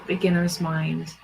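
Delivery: ordinary talk, nothing marked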